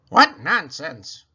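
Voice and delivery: loud, slow dismissal; scornful. A narrator's reading. Eugenia dismissing the outing, slow scorn. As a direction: drag out the contempt. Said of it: "said Eugenia Lincoln".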